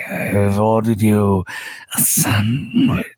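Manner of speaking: low, slow purring seductive voice; breathy vintage purr. Acted. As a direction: purr each word like a silky villain. GM voices Zul Grubub.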